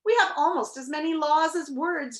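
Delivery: mildly grumbling, starting high; grumbled. A narrator's reading. King dismissing the Humbug's idea, mild grumble. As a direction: grumble it back, a touch weary. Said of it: "grumbled the king".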